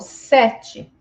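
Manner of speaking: "sete" drawn out with open eh; stressed open E in "sete". Shown as a demonstration. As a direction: say "sete" slowly as SEH-chee. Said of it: "tem este som".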